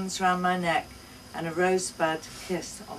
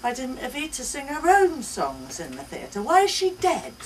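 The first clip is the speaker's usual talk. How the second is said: put-on high, loud child's voice, much higher; little girl questioning; 7 semitones higher, 6 dB louder than usual. A narrator's reading. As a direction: wonder aloud like a small girl.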